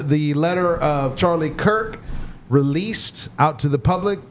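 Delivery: ordinary delivery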